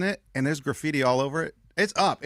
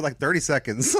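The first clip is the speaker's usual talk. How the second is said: normal talking voice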